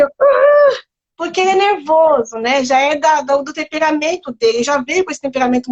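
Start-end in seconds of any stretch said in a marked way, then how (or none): 0.1-0.8 high mock yell of an angry child; imitated yell into pillow. Mother imitating her son yelling into his pillow, mock yell. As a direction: let out a short high yell like a boy into a pillow. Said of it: "e grita assim".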